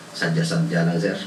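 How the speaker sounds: put-on low, mumbled kid's excuse; sheepish student reply. Students excusing themselves to the pastor, low and sheepish. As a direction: mimic a caught student shrugging it off. Kids answering pastor's question.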